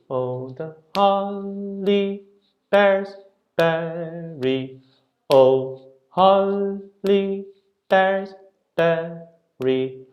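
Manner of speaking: sung fragments, holly, bears, berry punched; only the beat words. Shown as a demonstration. Teacher sings only the beat words to show the skeleton. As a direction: sing just the stressed words. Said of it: "That's really what you're singing".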